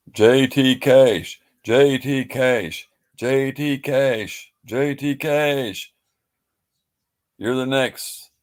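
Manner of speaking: high, loud, drawn-out call-out; big mock game-show summons; game-show announcer call. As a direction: call a contestant down, stretching each word.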